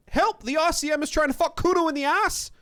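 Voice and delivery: high, loud cry for help; squealing boy raising the alarm; child's shout. A narrator's reading. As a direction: yell the accusation shrill for everyone to hear. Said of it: "the child shouts at the windows".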